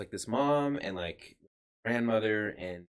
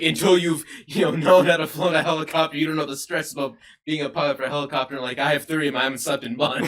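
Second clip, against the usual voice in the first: mock stressed pilot; 4 semitones higher, 8 dB louder, faster than usual. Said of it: "come up to me and be like".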